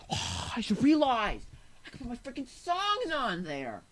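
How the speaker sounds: high, drawn-out excited outburst; sudden realization. Real, not acted. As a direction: blurt it out, stretching the key word. Sudden realization mid-song.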